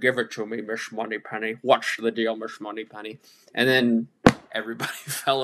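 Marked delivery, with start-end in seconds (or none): 0.0-3.2 light Connery slur on the s in Miss; a hint of Connery's slushy s; Sean Connery impression. Podcaster quotes Connery on stage, lightly slurred s. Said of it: "he came on stage and said".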